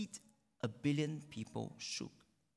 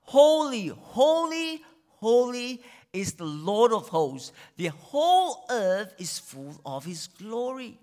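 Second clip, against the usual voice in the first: loud, ringing high proclamation of holy; full-voiced angelic proclamation; seraphim crying out; 7 semitones higher, 10 dB louder, slower than usual. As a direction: cry it out like angels before the throne. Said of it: "they were crying out".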